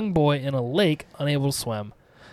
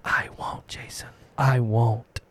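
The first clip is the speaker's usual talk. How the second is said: whispering; 4 semitones lower, slower than usual. Acted. Killer answers dead son.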